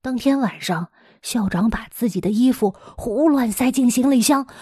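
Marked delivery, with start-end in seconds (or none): none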